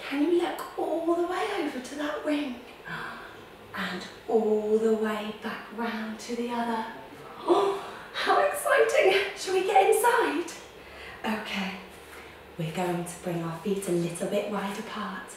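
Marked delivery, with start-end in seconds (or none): 0.1-2.6 high, awed and wondering; a high awed question to the children; excited. Acted. Awed at the spaceship. 7.4-10.5 high, loud, laughing excitement; thrilled high voice inviting the children aboard; excited. Acted. Pretend excitement at spaceship.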